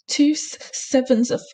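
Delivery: put-on high, stammering, halting boy's voice; a terrified boy gasping out the answer; gasped stammer. A narrator's reading. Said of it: "gasped Ru".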